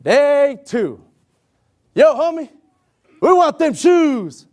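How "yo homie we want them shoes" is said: menacing inmate. Retold or imitated. Inmates demanding shoes.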